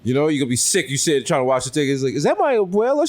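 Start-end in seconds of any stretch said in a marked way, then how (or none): 2.1-3.1 high-pitched incredulous question; shocked disbelief. Acted. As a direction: spot grandma where she shouldn't be. Acted fan spotting grandmother on field.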